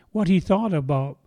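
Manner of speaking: ordinary talk, nothing marked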